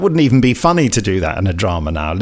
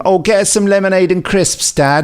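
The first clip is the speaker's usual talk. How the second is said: put-on quoting the girl; 7 semitones higher than usual. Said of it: "the girl just says to him".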